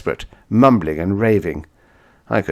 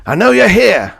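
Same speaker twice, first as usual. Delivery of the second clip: loud, much higher furious scream; 14 semitones higher, 10 dB louder than usual. Acted. Narrator screams at his enemy in the bar, loud fury. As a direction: scream it at the hidden man. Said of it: "I screamed".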